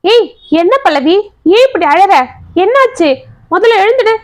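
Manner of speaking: high-pitched, urgent questioning; alarmed concern. A narrator's reading. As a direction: urge a weeping wife up, startled. Alarmed husband lifts his fallen wife.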